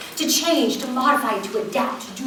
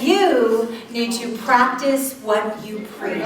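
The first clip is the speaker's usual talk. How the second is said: slow pointed advice; 3 semitones lower, much slower than usual. Retold or imitated. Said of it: "She said".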